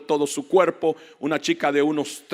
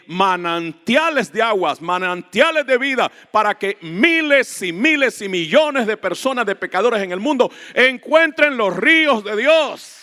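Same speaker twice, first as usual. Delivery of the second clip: high, loud sermon climax; impassioned preaching; 7 semitones higher, 7 dB louder than usual. Real, not acted. Preacher calling for millions to find God's rivers, impassioned preaching.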